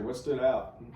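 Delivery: usual speaking voice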